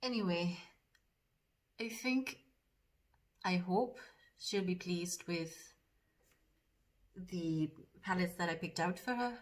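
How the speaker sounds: put-on lightly done Geordie accent, a bit fast at first; chatty Geordie accent while doing makeup; a hint of Geordie accent in casual chat. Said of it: "that's about as much of the accent".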